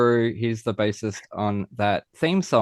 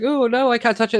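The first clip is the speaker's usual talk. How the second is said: high, whining mock complaint; mock whiny soccer player; 11 semitones higher, faster than usual. Mocking soccer players to friends, high whiny voice.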